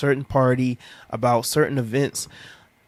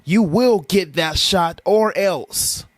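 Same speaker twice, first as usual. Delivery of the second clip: slow, louder menacing decree; hammed-up ominous order; mock authority threat; 4 semitones higher, 4 dB louder, much slower than usual. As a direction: parody the news threat. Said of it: "go on the news".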